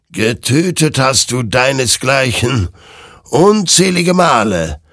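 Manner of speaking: accusing. A narrator's reading. Creature blames Hector for killing his own kind, accusing. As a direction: hurl the charge, swelling on the last words. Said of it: "warf die Kreatur ihm vor".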